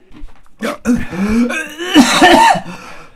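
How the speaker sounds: raspy high choking cough. Real, not acted. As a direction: cough and retch in distress. Choking on hot sauce.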